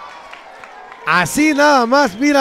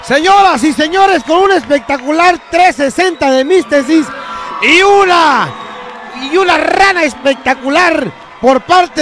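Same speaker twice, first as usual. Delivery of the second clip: excited announcer; 4 semitones higher than usual. Acted. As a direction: proclaim the victory to the crowd. Announcer proclaims the winners.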